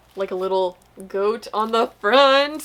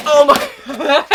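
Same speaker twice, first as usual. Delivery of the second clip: loud, high laughing exclamation; words breaking into a big laugh; bursting into laughter; 8 semitones higher, 8 dB louder than usual. Real, not acted. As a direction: crack up in real surprise.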